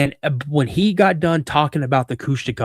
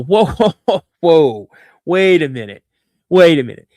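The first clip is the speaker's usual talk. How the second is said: incredulous interruption; 2 semitones higher, 7 dB louder than usual. Retold or imitated. Teenager stops dad mid-story, acted incredulous interruption. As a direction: break in loudly, putting on disbelief.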